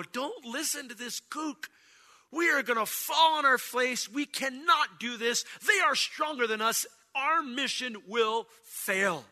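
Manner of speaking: high, emphatic naysayer voice; dissenting spies. Acted. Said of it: "a direct contradiction to Caleb's statement".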